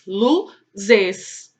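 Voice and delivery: "Luzes" in two beats, "Lu", pause, "zes"; split into syllables. Shown as a demonstration. Teacher confirming two syllables, "Luzes" syllable by syllable.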